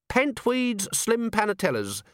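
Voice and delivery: the recruit names the commander's cigars at once, high; automatic reply. Acted. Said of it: "said Littlebottom automatically".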